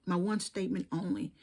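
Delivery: ordinary talk, nothing marked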